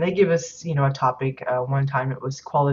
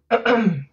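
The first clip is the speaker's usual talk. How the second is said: throat clearing; 7 semitones higher, 5 dB louder than usual. Real, not acted. Clears throat after coughing fit.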